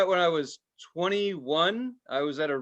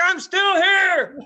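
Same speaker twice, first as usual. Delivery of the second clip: very high, loud, slow strained whine; pathetic plea; 8 dB louder, much slower than usual. Retold or imitated. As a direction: call out feebly from the tent. Tripper calling from tent.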